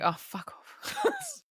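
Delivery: very high, slow, soft mock disgust; disgusted squeak. Cohost voices Marian's disgusted face, squeaky and slow. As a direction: squeak it out dripping with contempt. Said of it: "her face is just like".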